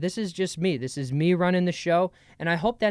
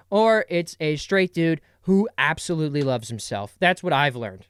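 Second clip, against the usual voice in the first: normal talking voice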